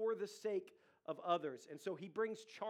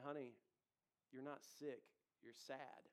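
much softer, tender reassurance; gentle wife; 5 semitones lower, 11 dB quieter than usual. Retold or imitated. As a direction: softly set him straight, like a caring wife. Wife gently correcting husband.

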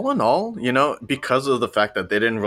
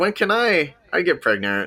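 mock little girl; 9 semitones higher than usual. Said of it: "Samantha's like".

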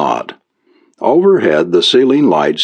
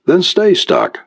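higher, sugary mock kindness; mock kindly; 11 semitones higher than usual. A narrator's reading. Reporter taunting the stuck businessman, falsely kind. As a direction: say it sweetly to twist the knife. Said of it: "in a kindly tone".

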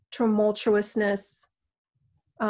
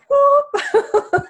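excited whoop; 7 dB louder than usual. Real, not acted. Excited outburst during healing session.

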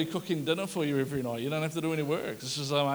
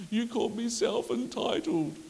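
put-on crying boy; 6 semitones higher, 4 dB quieter than usual. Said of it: "He goes".

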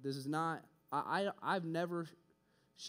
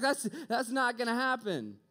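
louder, higher, dismissive; loud flat refusal; 9 semitones higher, 8 dB louder than usual. Retold or imitated. Student refusing God's plan, loud and dismissive. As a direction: shut the idea down. Arguing back to God.

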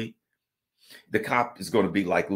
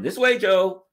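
high, loud coaxing aide voice; high coaxing helper; mock aide guiding; 11 semitones higher, 9 dB louder, slower than usual. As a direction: steer an old man gently to the stand. Aide guiding Biden by arm.

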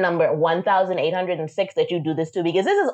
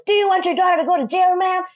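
fast, high-pitched imitation of a yelling officer; mock yelling; 13 semitones higher than usual. Officer threatening a mother with daughter's jail, mock yelling. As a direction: mimic a cop yelling a threat, high and rushed. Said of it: "yelling at my mom".

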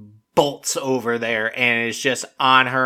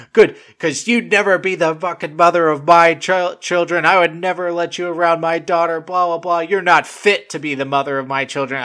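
higher-pitched, worked-up imitation of an argument; mock angry retort; 5 semitones higher than usual. Man firing back at a woman in a quarrel, mock angry. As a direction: throw it back like an offended dad, lightly hammed-up.

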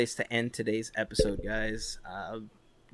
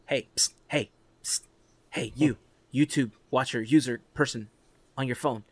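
psst for attention. Pretend. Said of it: "That's what the YouTube app does".

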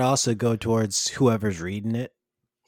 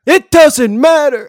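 high, booming drawn-out shout; stretched, full-volume catchphrase yell; The Rock catchphrase; 12 dB louder, much slower than usual. Acted. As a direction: bellow it like a wrestler cutting a promo.

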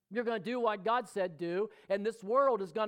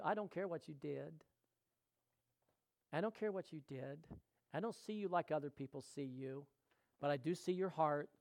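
Jesus, gently; 2 semitones lower, 8 dB quieter than usual. Acted. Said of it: "Jesus is saying the same thing".

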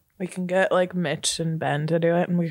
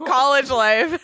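laughing hard through the words; 13 semitones higher, slower than usual. Real, not acted. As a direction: laugh it out high. Laughing at college snack cure.

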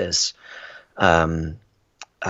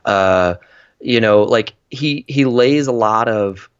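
usual speaking voice

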